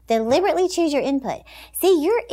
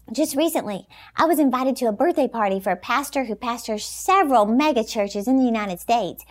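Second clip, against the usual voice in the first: ordinary talk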